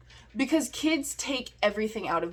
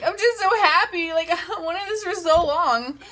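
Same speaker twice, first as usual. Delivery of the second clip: happy tears; 7 semitones higher, 3 dB louder than usual. Retold or imitated. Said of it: "I just started crying".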